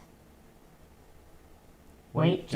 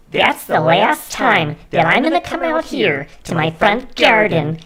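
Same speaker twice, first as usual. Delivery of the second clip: angry Karen rant; 5 semitones higher, 6 dB louder than usual. Pretend. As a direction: yell at the drone kids from the garden. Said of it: "Karen voice".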